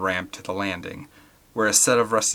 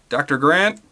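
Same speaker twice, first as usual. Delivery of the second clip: louder, higher uncertain call; nervous call; 6 semitones higher, 7 dB louder than usual. Acted. Paul calling nervously across the foggy catwalk. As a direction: call into the fog, unsure. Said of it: "He sounded nervous".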